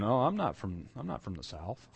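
put-on lightly done Southern US accent, soft. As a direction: imitate a Southerner denying their Southern accent. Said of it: "talking like this and saying".

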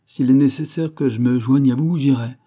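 captain calling across to friends. Acted. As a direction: keep shouting across. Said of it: "nous cria le capitaine Hod".

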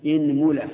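إنملة with the first vowel as i, inmula; إنملة with kasra, i-n-mula; kasra on the hamza. Shown as a demonstration. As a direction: say إنملة starting with a clear i sound. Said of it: "اكسر الهمزة".

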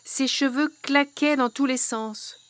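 usual speaking voice

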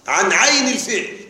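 normal talking voice

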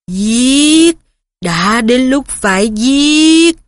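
slow, cold, hungry monster voice; cold bloodthirsty voice. A narrator's reading. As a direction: drag it out like a starving beast.

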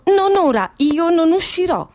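emphatic, stubborn no; defiant refusal. Acted. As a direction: refuse outright, pitch jumping up. Refusing to come out, agitated monologue.

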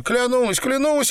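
very high, frantic swearing; desperate growled oath. A narrator's reading. Tortured man swears to captors, desperate high cry. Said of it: "рычал Апельсин".

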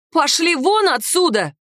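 high, slowed angry dismissal; indignant, drawn-out command; enraged order. A narrator's reading. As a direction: order them out in a rising temper. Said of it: "я так разъярилась, что вспыхнула".

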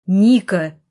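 loud, short shout of a name; barked call. A narrator's reading. Brother shouts after his departing sister, barking. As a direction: call after her forcefully. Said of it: "гаркнул я".

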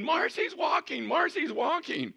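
high, loud repeated cry; excited yelling. Retold or imitated. Nurse running to the nurse's station, excited yelling. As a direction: yell the news down the corridor in a high voice.

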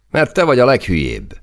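high, loud gleeful jab; jeering amusement. A narrator's reading. Soldier mocks his sulking comrade, jeering with amusement. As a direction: crow the insult at your friend. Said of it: "röhögött fel a beszólásán Zó".

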